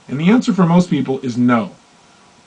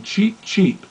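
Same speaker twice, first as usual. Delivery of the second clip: light chirpy cheep, a little higher; soft mock chick cheeps; baby turkey cheep; 5 semitones higher, 3 dB quieter than usual. Imitating baby turkey sound.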